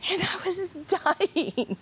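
recalling the embarrassing moment while laughing. Real, not acted. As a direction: crack up remembering it.

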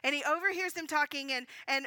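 usual speaking voice

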